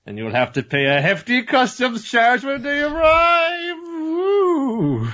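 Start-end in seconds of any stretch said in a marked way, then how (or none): 1.0-3.8 dodgy salesman voice. Pretend. Mocking a dodgy doll seller. 3.9-5.1 high-pitched cheering woo. Pretend. Jokey cheer after the seller bit.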